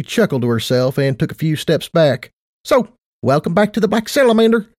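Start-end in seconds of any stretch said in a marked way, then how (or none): 2.6-4.7 much higher, perky affectionate mare; perky Tight Lips voice. Acted. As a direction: play a bubbly casino boss.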